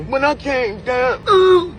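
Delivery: slow, whiny fake sob; mock crying. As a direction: wail it out slowly like a crybaby. Mocking the fall with fake crying.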